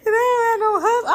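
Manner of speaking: words breaking through real tears; crying out. Real, not acted. Alone and unmarried, sobbing high and loud. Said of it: "I was tripping".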